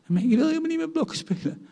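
put-on high, fast childish protest; whiny child. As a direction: whine it like a stubborn toddler. Child refuses to play with blocks.

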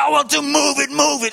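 loud, high, impatient shout; hammed-up rush to get moving; eager shout. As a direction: burst out like an eager man who wants to run. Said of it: "En dat is voor mij moeilijk".

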